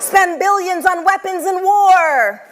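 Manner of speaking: high, loud, slow prompt; emphatic call-out. Acted. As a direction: call it out slowly to the crowd. Prompting audience call and response.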